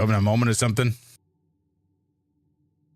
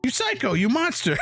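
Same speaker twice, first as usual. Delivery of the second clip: high squeaky furious yell; angry squeaky yell. Acted. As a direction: yell it like an outraged ant. Ant yells.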